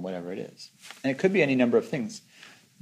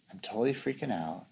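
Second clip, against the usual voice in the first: quiet admission; 8 dB quieter than usual. Retold or imitated. Meditator stopping to label their state, quiet admission. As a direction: admit it softly to yourself, as if retelling.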